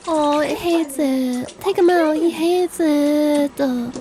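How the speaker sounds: ordinary talk, nothing marked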